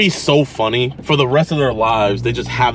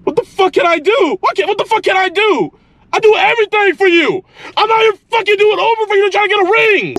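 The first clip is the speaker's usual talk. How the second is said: screaming, pitch way up, much louder; furious yelling; 5 dB louder than usual. Acted. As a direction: yell it in outrage, full volume. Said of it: "Why are you yelling?".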